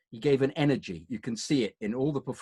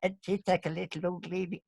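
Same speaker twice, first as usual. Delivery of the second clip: put-on high, soft old-lady voice; little old lady; 6 semitones higher, 5 dB quieter than usual. Grandmother acts old at sixty, little old lady voice. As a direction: imitate grandmother playing old, pitched up. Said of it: "at sixty decided to be old".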